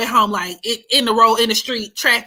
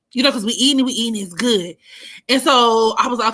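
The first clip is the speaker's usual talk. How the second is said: usual speaking voice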